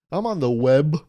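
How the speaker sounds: a hint of an old-timer saying web; mock old-timer. Shown as a demonstration. Old-timer naming the internet the web, lightly put on. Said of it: "like the web".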